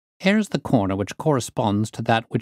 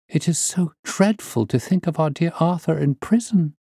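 high-pitched, earnest niece's plea; pleading niece; 8 semitones higher than usual. Acted. Niece begging her uncle to drop the matter, high and pleading. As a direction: beg your uncle in a higher voice.

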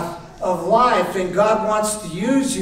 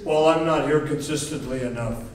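low, softer mock excuse; excuse-making; 5 semitones lower, 5 dB quieter, faster than usual. Member telling why they can't serve, mock reluctant. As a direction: lightly mimic a reluctant member. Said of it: "But what we do is".

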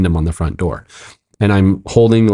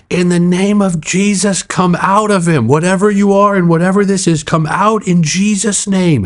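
re-enacted charismatic exorcism; 10 semitones higher than usual. Pastor laying hands on the man, high charismatic exorcism prayer. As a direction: command the spirit out, pitched high and stretched. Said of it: "going back to charismatic roots".

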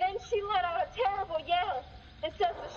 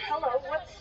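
ordinary talk, nothing marked

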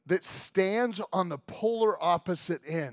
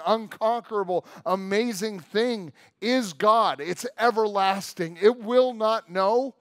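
louder, higher, building proclamation; full-force preaching; 4 semitones higher, 7 dB louder than usual. Acted. Preacher to congregation about everlasting God, proclaiming. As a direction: preach it to the back row.

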